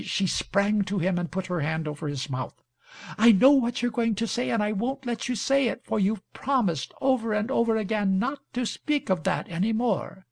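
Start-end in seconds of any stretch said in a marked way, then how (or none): 3.2-10.3 put-on higher-pitched young woman cutting him off; 倔强的Imogene，急切打断. A narrator's reading. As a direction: read her insistent protest in a raised pitch.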